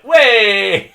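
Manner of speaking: high, loud whoop; mock excited cheer. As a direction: cheer like you're gladly joining in. Mimics eagerly joining the group.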